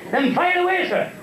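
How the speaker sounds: very high eager little character; high comic voice, ready to go; little Paddy. Acted. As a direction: pipe up as a game little fellow. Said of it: "Little Paddy come in".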